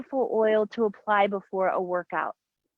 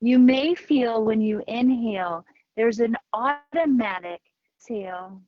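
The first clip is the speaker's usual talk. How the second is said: guided meditation. Acted. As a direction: guide them into the breath, unhurried. Leads breathing meditation over music.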